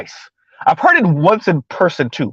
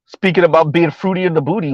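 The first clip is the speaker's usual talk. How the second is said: ordinary delivery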